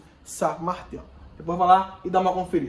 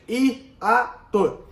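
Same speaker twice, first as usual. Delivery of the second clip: "Hiato" sounded out as hi, a, to; three separate beats on "Hiato"; syllable by syllable; 2 semitones higher than usual. Shown as a demonstration. Teacher repeating the three syllables for the class, syllable by syllable. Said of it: "São três sílabas".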